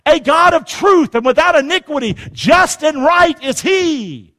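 high, ringing preacher's recitation; declamatory Bible reading from the pulpit; scripture reading. Real, not acted. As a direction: proclaim each verse with punched key words. Reads scripture aloud forcefully.

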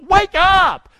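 a preacher jolting the church awake; shouted. Acted. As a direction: bellow it at the congregation. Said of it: "That'll help you get rid".

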